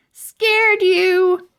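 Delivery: high, loud teasing shout; gleeful yell. Acted. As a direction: yell it to spook her. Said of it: "yelled Stink".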